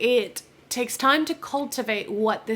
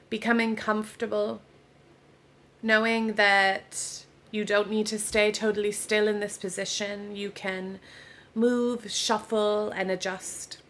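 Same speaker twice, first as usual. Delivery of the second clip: guided meditation; 3 dB quieter than usual. Real, not acted. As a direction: ease them into rest, unhurried, with long pauses. Leads a yoga nidra relaxation.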